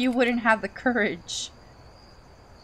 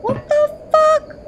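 much higher, loud disbelieving shout; shocked yell; 10 dB louder than usual. Real, not acted. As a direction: blurt it out in sheer shock. Shocked reaction to game event.

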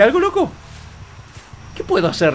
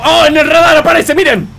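excited discovery; 6 semitones higher, 5 dB louder than usual. Real, not acted.

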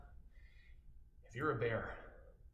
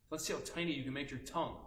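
ordinary talk, nothing marked

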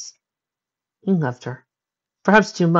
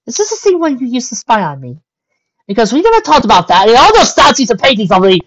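high, loud indignant unicorn lady; accusing him, much louder; 11 semitones higher, 10 dB louder than usual. Acted. Rarity confronting Spike.

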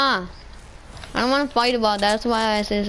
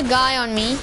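normal talking voice

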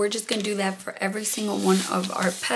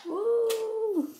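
excited woo; 13 semitones higher than usual. Real, not acted. Hot metal, reacting to heat.

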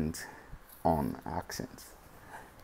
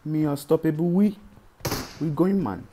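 put-on Dominican accent; 9 semitones higher, 5 dB louder than usual. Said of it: "we using the Dominican accent today".

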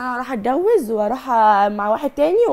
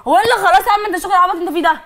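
much higher, louder furious yell; over-the-top shouting; 6 semitones higher, 5 dB louder, faster than usual. Real, not acted. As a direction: scream at him, fully losing it. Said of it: "ما تأفوريش قوي كده". (صراخ هستيري غاضب)

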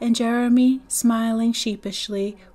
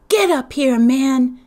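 loud, raised order to a little brother; 4 semitones higher, 8 dB louder, slower than usual. Acted. Stacy calling down to his little brother, commanding. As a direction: bark it down from the bank. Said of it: "Stacy ordered".

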